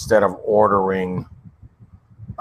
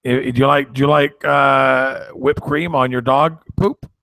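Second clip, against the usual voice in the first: challenging him with an absurd comparison; mock indignant; 6 semitones higher than usual. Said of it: "I go".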